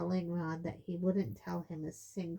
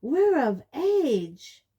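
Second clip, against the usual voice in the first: slow, very loud, high bellow; 8 semitones higher, 12 dB louder, much slower than usual. A narrator's reading. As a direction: bellow it in protest. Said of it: "Fred and George bellowed together".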